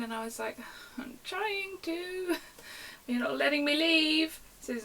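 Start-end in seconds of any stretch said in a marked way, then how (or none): 1.0-4.4 mock wail, very slow and high; hammed-up tearful protest; emotional. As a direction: plead helplessly, dragging it out and getting louder. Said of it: "getting really, like, emotional".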